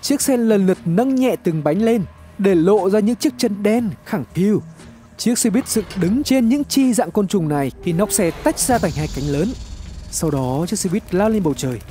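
plain reading voice

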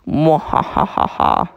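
slow evil mwahaha cackle; slow villain cackle; mad scientist laugh. Pretend. Evil laugh after boast.

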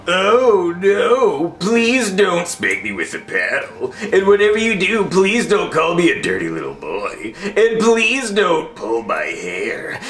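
hammed-up, slow begging; mock pleading. Fake pleading, actually wants spanking.

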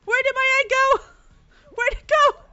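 high loud panicked calls; shrill hurried shouting; frantic urging. Real, not acted. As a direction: rush and hunt in a panic. Frantic search for her egg.

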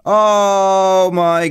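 long, drawn-out high wail; despairing groan. Real, not acted. Gamer despairing over lost gear, long wail. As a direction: stretch it out slowly in dismay.

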